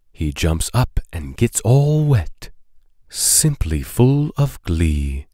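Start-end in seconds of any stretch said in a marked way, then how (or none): none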